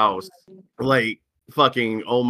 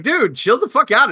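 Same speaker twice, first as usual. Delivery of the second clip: higher, louder "chill out" at a raging friend; exasperated yell; 11 semitones higher, 5 dB louder than usual. Retold or imitated. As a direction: snap at a friend throwing fists.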